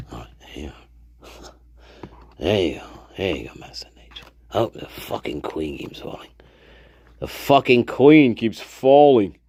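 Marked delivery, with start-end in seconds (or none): 3.2-4.3 hushed cursing whisper. Real, not acted. Whispered frustration at cards. 4.5-6.3 whispered. Real, not acted.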